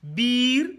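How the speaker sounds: "Biir" stretched out with a rise; long, rising i in "Biir"; long vowel. Shown as a demonstration. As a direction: hold the ii in "Biir". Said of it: "Voyez la différence".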